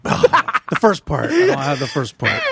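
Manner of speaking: talking through marshmallows; muffled words round a full mouth. Real, not acted. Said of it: "such a good job of it".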